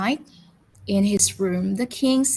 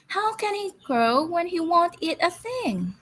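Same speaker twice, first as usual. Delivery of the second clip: a higher, worried queen's voice; fretting queen; 8 semitones higher than usual. Acted. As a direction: sigh it out like a fussy royal mother. Queen complains to king.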